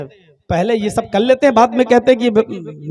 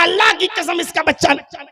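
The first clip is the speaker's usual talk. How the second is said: shouted denial; 8 semitones higher, 3 dB louder than usual. Acted. Man swearing back in quarrel.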